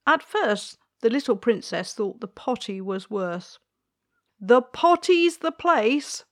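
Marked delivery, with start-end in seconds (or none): 4.4-6.2 higher, louder and slower insistent queen. Acted. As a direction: stretch out the place, firmly repeating. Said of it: "said the queen".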